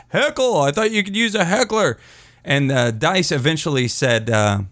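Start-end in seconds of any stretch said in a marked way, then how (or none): 0.1-2.0 high mock heckler voice; high silly holler, easing slightly; retold heckler. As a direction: imitate a clueless heckler calling out, pitched high. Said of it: "didn't say anything to Dice except just".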